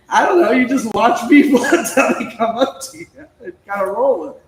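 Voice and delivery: loud, high, through laughter; laughing reply. Retold or imitated. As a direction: retell your own answer while cracking up.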